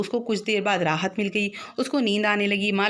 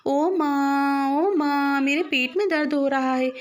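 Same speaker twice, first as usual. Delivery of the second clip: wailing for mother; 5 semitones higher, slower than usual. A narrator's reading. Boy with a bellyache calls his mother, drawn-out wail. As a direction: stretch the first cry out in pain. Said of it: "ज़ोर-ज़ोर से चिल्लाने लगा".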